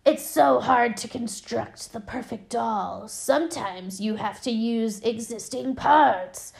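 put-on creepy doll voice; demonic doll. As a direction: imitate an eerie doll explaining her craft. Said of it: "she's like".

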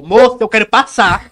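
loud, slow, firm demand; angry demand. Retold or imitated. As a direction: demand she move, firmly. Mother confronting woman blocking way.

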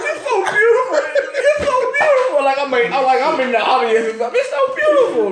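high, teary mock sobbing voice; mock crying. Imagining crying at his own wedding, mock teary. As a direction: pretend to cry out the words. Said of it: "imagining himself crying at wedding".

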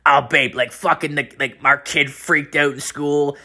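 a hint of a whining partner; upset girlfriend. Girlfriend reporting their kid's meltdown, lightly imitated. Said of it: "you hear the other line".

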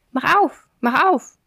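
high, urgent little hare; insistent calling hare. A narrator's reading. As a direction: call sharply to get the door opened. Hare's last urgent call.

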